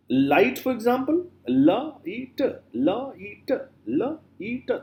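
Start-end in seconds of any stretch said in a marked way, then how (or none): 1.5-4.7 "la, e, t" sounded out one by one, raised pitch; split into sounds. Shown as a demonstration. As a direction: break it into "la, e, t", each sound separate. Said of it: "Three, you know, sounds".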